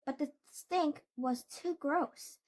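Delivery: ordinary delivery, nothing marked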